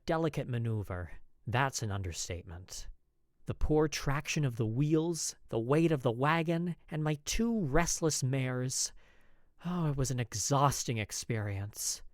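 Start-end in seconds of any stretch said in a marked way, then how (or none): none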